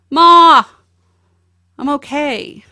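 high, loud call across an empty office; son calling out. Retold or imitated. Son calling to his mom from across the office, loud high call. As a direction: call out to your mom from far away.

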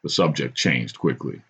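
ordinary delivery, nothing marked

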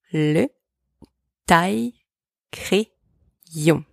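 Le, then taille-crayon very slowly; slow teaching model of Le taille-crayon; slow split demo. Shown as a demonstration. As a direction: stretch taille-crayon syllable by syllable. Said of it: "Repeat after me".

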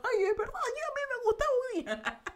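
a hint of a dreamy little old woman; future old self. Imagining herself old, listening to her podcast, lightly voiced old lady. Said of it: "si algún día me vuelvo viejita".

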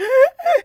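sobbing gasp. Retold or imitated. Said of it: "she's crying".

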